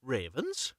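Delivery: ordinary delivery, nothing marked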